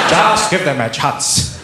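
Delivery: slow, loud statesman's plea; Nelson Mandela. Acted. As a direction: plead with grand, unhurried dignity. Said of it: "I love the Nelson Mandela voice".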